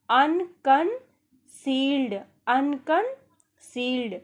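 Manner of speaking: अनकंसील्ड stretched slowly, syllable by syllable; slow dictation of अनकंसील्ड; slow, drawn out. Shown as a demonstration. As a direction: drill अनकंसील्ड slowly for the class. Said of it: "अनकंसील्ड को हिंदी में कहते हैं".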